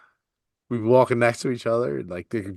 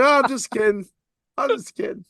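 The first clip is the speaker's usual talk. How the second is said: high, laughing "just kidding"; laughing tease; 12 semitones higher than usual. Retold or imitated. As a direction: giggle it out as a prank reveal. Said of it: "she'd be like".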